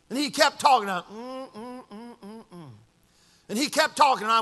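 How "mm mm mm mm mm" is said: very soft listening hums; sympathetic humming. Retold or imitated. Pastor listens to a man's troubles, soft hums. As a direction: hum quietly as if following along.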